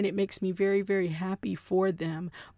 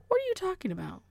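high-pitched, softer questioning take; softly puzzled, pitched way up; different inflection; 10 semitones higher, 4 dB quieter, much faster than usual. Shown as a demonstration. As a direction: ask it high and light, dropping at the end. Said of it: "it's all in the way that you say it".

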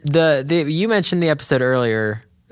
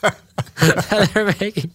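laughing; 7 semitones higher, much slower than usual. Real, not acted. Laughing over Muscle Man's reaction.

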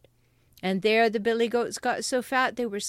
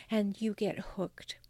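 whisper; 7 dB quieter than usual. Real, not acted. Warning listeners, whispered.

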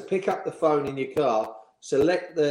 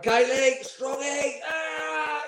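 high, strained call from the fed-up boss; exasperated manager; 14 semitones higher, much slower than usual. Retold or imitated. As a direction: yell the two names at the end of his tether. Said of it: "And he'd got".

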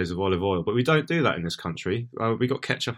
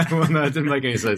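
higher, louder, laughing through the words; 7 semitones higher, 5 dB louder, faster than usual. Real, not acted. Co-host admitting his remark made no sense, laughing. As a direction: concede it through a real laugh.

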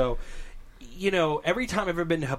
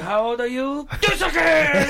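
high, loud hammed-up chef shout; mock chef accent; 9 semitones higher, 6 dB louder, much slower than usual. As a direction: yell it like a showy chef. Cook squirting sake at her.

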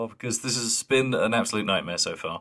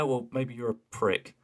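ordinary delivery

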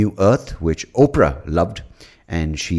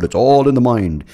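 smug mock advice; 6 semitones higher, 6 dB louder than usual. Mocking people who dismiss change.

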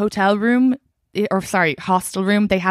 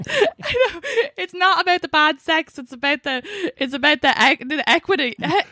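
laughing; 9 semitones higher than usual. Real, not acted. Laughing while explaining.

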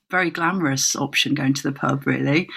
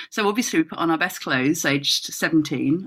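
usual speaking voice

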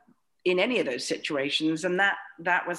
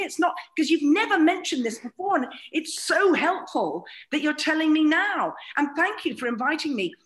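sarcastic; 8 semitones higher than usual. Retold or imitated. Sarcastic thanks.

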